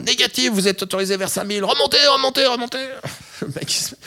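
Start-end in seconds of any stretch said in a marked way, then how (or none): 0.1-2.8 high, urgent repeated climb order; panicked controller shouting remontez; controller yelling. Retold or imitated. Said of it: "il gueule". (contrôleur paniqué qui gueule remontez, très aigu)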